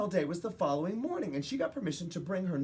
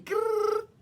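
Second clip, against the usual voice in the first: high dolphin growl; 5 dB louder than usual. Acted. Dolphin growl after quote.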